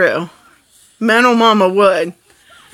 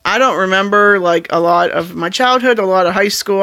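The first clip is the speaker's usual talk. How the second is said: ordinary talk, nothing marked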